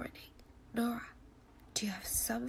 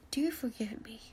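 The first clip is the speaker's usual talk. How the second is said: usual speaking voice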